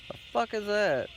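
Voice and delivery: sudden high startled outburst; real startled yelp at a noise. Real, not acted. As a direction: jump at a strange noise.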